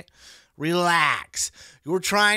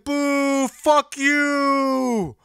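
mock crowd booing; 8 semitones higher, 5 dB louder, much slower than usual. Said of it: "And they're like".

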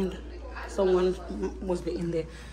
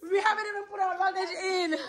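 high and fast, laughing; 5 semitones higher, 4 dB quieter, faster than usual. Real, not acted. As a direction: blurt it out laughing. Friend already in the pool.

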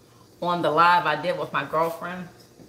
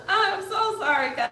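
high voice between laughing and crying; tearful apology; 12 semitones higher than usual. Real, not acted. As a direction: apologise through real tears. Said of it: "You making me cry".

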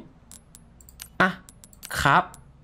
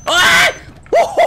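a sudden high, loud yelp of fright; startled scream; 6 dB louder than usual. Real, not acted. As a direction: jump out of your skin and yell. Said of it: "กูตกใจหมดเลย". (ร้องตกใจเสียงสูงดัง)

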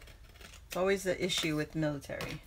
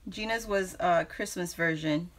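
usual speaking voice

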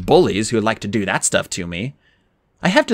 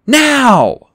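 high, loud burst; yelled; 13 semitones higher, 7 dB louder than usual. Acted. Annabeth yells the moment to jump, high and loud. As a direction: fire it off as a sharp yell. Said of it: "then yelled".

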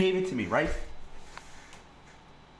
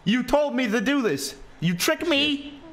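mock outrage; 9 semitones higher than usual. Mock complaint after removal.

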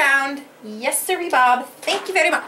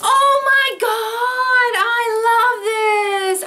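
high, slow, tearful sing-song; near tears; 7 semitones higher, 3 dB louder, much slower than usual. Real, not acted. Moved by a friend's gift, near tears. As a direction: nearly cry with joy. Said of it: "I'm shaking".

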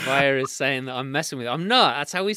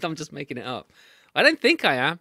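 ordinary talk, nothing marked

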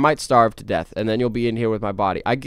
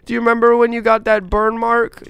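high, loud, slow mock sobbing wail; hammed-up crying; 10 semitones higher, 12 dB louder, slower than usual. Streamer playing it up for the camera, fake crying wail. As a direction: overplay the grief for the camera. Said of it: "I'm playing it up for the camera".

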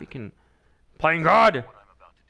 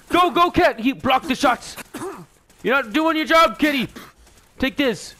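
excited high shouting; 9 semitones higher than usual. Real, not acted. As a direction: cheer the cat on mid-fight. Shouts during fight with cat.

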